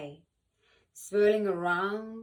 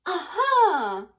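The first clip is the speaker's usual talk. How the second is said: loud, high burst of discovery; delighted Aha; 10 semitones higher, 10 dB louder than usual. Shown as a demonstration. Cookie shows how it sounds to solve a puzzle, a loud delighted Aha. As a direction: shout it like you just cracked the puzzle. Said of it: "Like this".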